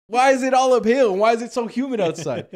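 loud, high, fed-up outburst; a big frustrated yell; exasperated rant. Retold or imitated. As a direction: complain to the sky about the hills and humidity. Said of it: "So I'm mad as hell".

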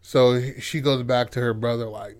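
plain reading voice

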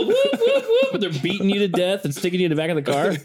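whooped siren noise, laughing; siren imitation. Mimicking police siren, laughing.

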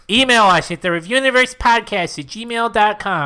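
upbeat, mock newsreel announcer; old-timey announcer. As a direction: pitch it like a vintage ad. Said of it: "I like this new character of yours".

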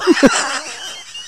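high burst of laughter. Real, not acted.

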